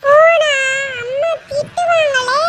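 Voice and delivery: put-on high, drawn-out kid whine; high childish reluctance; worried child. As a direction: whine slowly about getting in trouble. Child fears mother's scolding.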